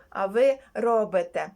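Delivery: normal talking voice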